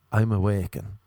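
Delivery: ordinary delivery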